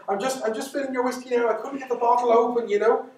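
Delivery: nervous stalling. Acted. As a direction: babble quickly to cover the fear. Mary stalling the creature nervously.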